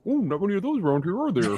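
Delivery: high squeezed frog voice, lips pursed small; a frog suddenly shrinking his mouth. Acted. Dad's joke punchline, frog answers alligator in high squeezed voice.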